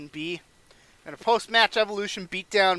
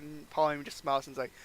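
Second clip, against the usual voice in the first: ordinary talk, nothing marked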